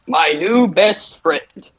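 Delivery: creepy slow line. Acted. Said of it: "Mort just goes".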